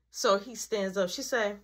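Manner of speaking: normal talking voice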